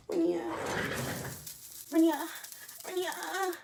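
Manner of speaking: lightly mock his needy calls in short, high bursts; high little repeated calls, imitating him; mock pestering. Imitating him pestering and calling her.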